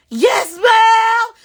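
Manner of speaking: high shouted yes ma'am; imitating the daughter, shouting high; daughter's shout. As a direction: yell it back high. Daughter confirming, retold.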